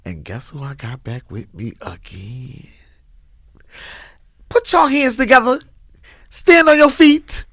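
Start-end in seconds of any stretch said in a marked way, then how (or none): 4.5-7.4 loud, high hype-man intro; rally speech. Acted. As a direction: pump up the crowd like an MC.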